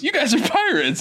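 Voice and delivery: high, incredulous Sokka accusation. Retold or imitated. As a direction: point it out in disbelief. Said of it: "Sokka literally just goes".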